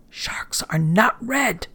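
slow, unvoiced whisper; frightened whisper. A narrator's reading. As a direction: whisper it slowly in fear. Frightened sailor insists.

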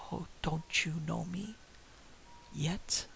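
soft, mournful ghost voice; ghostly wail. A narrator's reading. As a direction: mourn the question quietly. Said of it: "wailed the voice".

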